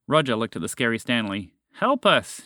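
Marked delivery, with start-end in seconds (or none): 1.8-2.4 high-pitched call for help; crying out. A narrator's reading. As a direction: cry out for rescue, pitch up. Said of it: "she cried".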